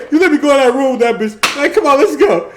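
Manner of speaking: fast, high quote through laughter; mock his complaint while laughing. Quoting angry friend, laughing.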